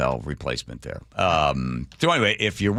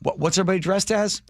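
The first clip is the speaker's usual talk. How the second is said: curious T cell; 10 semitones higher than usual. Pretend. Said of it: "the T cells are able to go".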